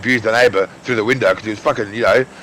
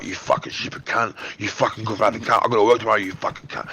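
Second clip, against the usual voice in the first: hushed mock of a scream; 4 semitones lower, 7 dB quieter than usual. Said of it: "you fucking scream at me through the window".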